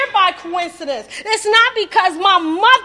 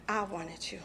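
tender and hushed; 10 semitones lower, 18 dB quieter than usual. Retold or imitated. God's words retold tenderly.

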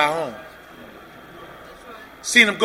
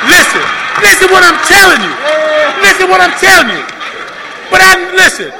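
loud, high, pressing the audience to listen; urgent plea; 8 semitones higher, 6 dB louder than usual. Real, not acted. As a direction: demand their attention, loud.

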